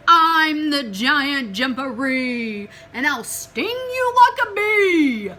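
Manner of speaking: slow and loud, rising to a high threat; boom, then lift the threat; booming giant voice. Acted. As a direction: giant bellowing out of the burrow.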